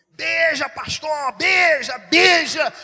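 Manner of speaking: high, very loud cheering call; full-voiced mock crowd cry; imitated crowd shout. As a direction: shout like excited passengers egging someone on. Acting out cheering passengers' chant.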